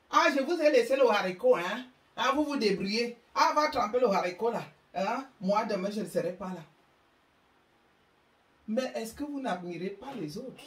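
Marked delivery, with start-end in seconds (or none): 0.1-6.6 put-on loud, high and fast brush-off to the kids; careless mother leaving. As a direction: toss orders over the shoulder on the way out. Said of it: "Certaines même vont au restaurant".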